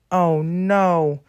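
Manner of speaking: high, dismayed apology; distressed cry. Acted. Engine apologizing to derailed coaches, distressed cry. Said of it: "cried Stanley".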